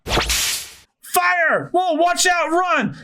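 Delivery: high-pitched battle alarm; alarmed shouting. Acted. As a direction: cry a frantic warning under cannon fire. Acted battle alarm under fire.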